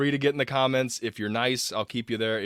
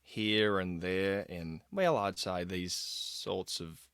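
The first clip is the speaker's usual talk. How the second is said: very slow, low, softer Aussie delivery; Graham Oppy; 4 semitones lower, 7 dB quieter, much slower than usual. Retold or imitated. Host imitating a slow Australian speaker, unhurried accented delivery. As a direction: drag it out, in no rush at all. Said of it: "very slow".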